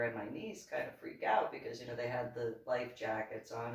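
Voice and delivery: ordinary talk, nothing marked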